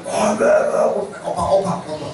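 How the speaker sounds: Godfather voice. Retold or imitated. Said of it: "itu kayak Godfather".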